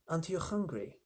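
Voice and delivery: soft, altered spider voice. Acted. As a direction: lean in and purr the question. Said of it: "she asked suddenly".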